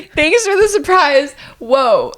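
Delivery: high, slow, stunned thanks; dazed mock thanks. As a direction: drawl it out, dumbfounded and ironic. Mocking dazed reaction.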